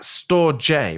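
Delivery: "Store J" said as store plus the letter J; two-word pronunciation. Real, not acted. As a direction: stretch "Store J" slowly into store-JAY. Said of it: "Is that how it's pronounced".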